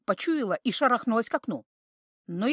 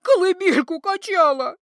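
loud, high tearful pleading; sobbing desperate plea; crying; 8 semitones higher, 7 dB louder than usual. A narrator's reading. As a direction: wail through tears. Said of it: "плакала Кикимора".

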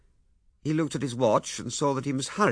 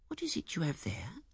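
whisper; 8 semitones higher, 7 dB quieter than usual. Acted. Wife's soft question in narration.

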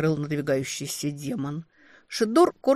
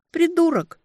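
high playful jab; playful retort; 13 semitones higher, 6 dB louder than usual. Acted. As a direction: call him an idiot fondly. Affectionate retort.

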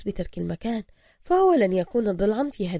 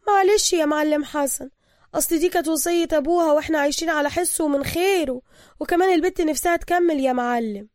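higher, pleading female voice; pleading boss woman; 8 semitones higher, 5 dB louder than usual. Acted. Mahasen apologizing to the thug, pleading. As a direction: soften him with excuses.

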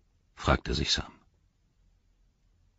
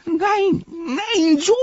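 high, whining no no; whimpering Smeagol; 4 dB louder, much slower than usual. Acted. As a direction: wail it in a thin high voice. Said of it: "jammerte Smergol".

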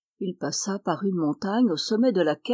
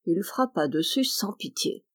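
ordinary delivery

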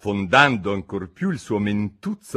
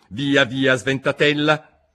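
higher, louder, pompous duchess; 6 semitones higher, 6 dB louder, slower than usual. A narrator's reading. Duchess lectures Alice, pompous and higher. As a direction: preach a moral she cannot recall.

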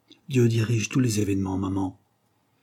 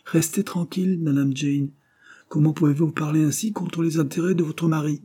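lightly mocking, pitched up on Restez; mock formal teasing; 7 semitones higher than usual. A narrator's reading. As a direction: poke fun at his sister with mock politeness. Robert mocks Jane as madame.

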